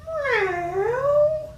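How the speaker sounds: squeaky cartoon cat; cat meow. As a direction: mew like a little kitten.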